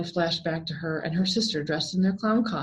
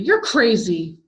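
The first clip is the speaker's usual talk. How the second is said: sharp loud refusal; angry; 3 semitones higher, 7 dB louder, slower than usual. A narrator's reading. Jessica refusing the clown, angry loud no. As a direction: snap it at the clown. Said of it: "Jessica replied angrily".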